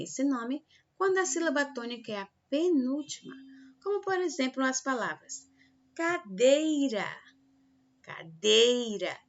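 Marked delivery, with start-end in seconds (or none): 5.9-9.2 cadeira, loud and slow on dei; slow demonstration of cadeira, weight on dei; stress on middle syllable. Shown as a demonstration. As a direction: stretch and push the dei in cadeira. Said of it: "dei é a mais forte".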